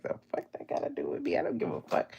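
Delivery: low, fast, hushed muttering; sleepy low mutter at the guy; muttered dismissal. Retold or imitated. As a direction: grumble it under your breath. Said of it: "I'm like".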